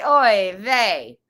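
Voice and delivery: loud, hammed-up Italian accent. Shown as a demonstration. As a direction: belt it out with a mock Italian lilt. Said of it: "say it with an Italian accent".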